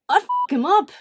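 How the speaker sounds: loud, high angry threat; real outrage, shouted high; furious outburst. Real, not acted. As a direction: blow up at the very idea. Outraged about cheating with minor.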